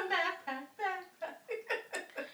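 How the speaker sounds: giggling repeats; laughing. Real, not acted. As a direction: laugh through the words.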